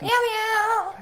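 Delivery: high meowing like a cat. Pretend. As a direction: meow like a cat. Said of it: "now you sound like a cat".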